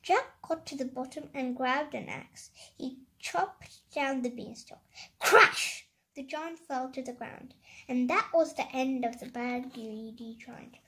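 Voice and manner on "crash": sudden high, loud exclamation of impact; loud crash. A narrator's reading. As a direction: burst out with the crashing sound. Giant's fall imitated in story.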